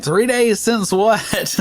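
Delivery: high and slow, baffled; can't follow the caller at all; puzzled staff. Retold or imitated.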